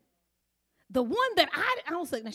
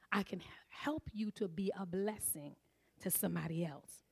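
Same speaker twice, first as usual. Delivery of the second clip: whisper; 5 semitones lower, 10 dB quieter than usual. Retold or imitated. God's gentle voiced counsel.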